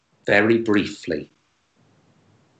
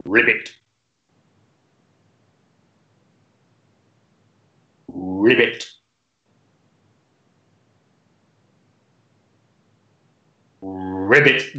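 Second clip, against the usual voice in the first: frog voice; much slower than usual. Acted. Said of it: "frog with a lisp".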